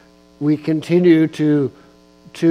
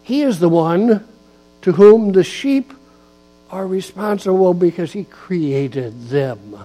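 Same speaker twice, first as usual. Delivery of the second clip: louder emphatic preaching with punched words; 3 semitones higher, 4 dB louder than usual. Acted. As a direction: drive each phrase home to the pews. Preaches on Psalm 23.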